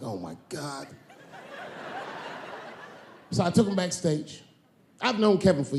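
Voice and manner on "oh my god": exasperated aside. Retold or imitated. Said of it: "I was like".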